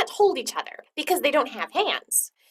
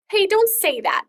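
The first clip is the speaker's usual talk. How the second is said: exclaiming; 10 semitones higher, 8 dB louder than usual. Acted. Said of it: "Raphael exclaimed".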